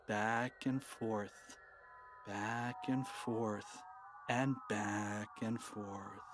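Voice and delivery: lulling the listener like a swinging ring; slow and swaying. A narrator's reading. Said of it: "eyes followed the ring back and forth".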